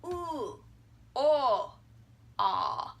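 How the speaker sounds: "ooh, oh, ah" each held long and separately; drawn-out back vowels. Shown as a demonstration. Teacher maps back of the mouth, drawn-out vowels. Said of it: "And over here".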